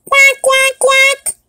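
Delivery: very high squeaky quack; duck quack. Acted. Duck introduced as the neutral party, very high quack.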